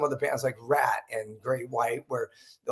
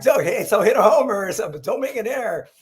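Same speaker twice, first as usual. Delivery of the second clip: laughing retelling; 7 semitones higher, 4 dB louder than usual. Retold or imitated. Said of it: "he's just trying to be funny".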